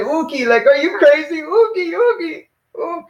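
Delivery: very high, disbelieving refusal; incredulous emphatic no. Shown as a demonstration. Teacher demonstrates a convincing no to the student, incredulous. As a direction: protest as if the question is crazy. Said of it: "you gotta be more convincing".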